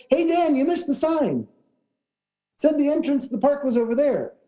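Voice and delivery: high call to the driver. Retold or imitated. Passenger telling driver about the missed sign, calling out. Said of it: "I call out from the back".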